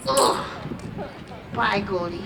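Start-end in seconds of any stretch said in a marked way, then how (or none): none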